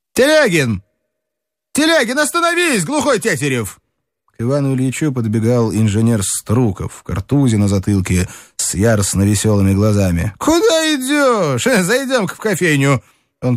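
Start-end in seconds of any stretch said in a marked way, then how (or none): 0.1-3.8 calling out. Acted. Strukov calls to Telegin in street. 10.4-13.0 excited call. Acted. Strukov grabs Telegin, excited.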